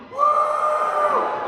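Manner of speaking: high whooping party yell "Woo!"; drunken woo shout. Retold or imitated. A guy at the bar whooping drunk, loud high woo. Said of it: "you're like".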